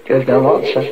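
ordinary delivery

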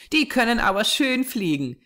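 put-on high child's voice, merry; cheerful little girl. A narrator's reading. Small girl clapping at the circling buzzards, cheerful. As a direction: call out gladly in a small girl's high voice. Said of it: "rief sie fröhlich".

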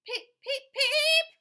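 very high, loud little beeps; squeaky duckling peeps. Acted. Reading to a child, duckling peeping squeakily. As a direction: peep like a tiny duckling.

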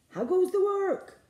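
high, loud farmer's call; inviting children to join the farmer. A narrator's reading. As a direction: say it like the lazy farmer.

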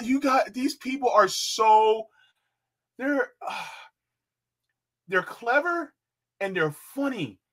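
very high squeal, settling slowly; giddy with laughter. Real, not acted. Host marvels at the co-op sex shop's clever name, giddy with laughter. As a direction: gasp it out, overcome with amusement. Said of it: "It makes you giddy all inside".

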